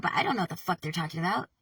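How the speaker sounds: normal talking voice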